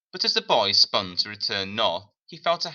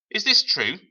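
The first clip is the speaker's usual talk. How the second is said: much higher, louder sharp demand; the woman's raised, pointed question; 10 semitones higher, 6 dB louder than usual. Acted. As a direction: demand the truth from him.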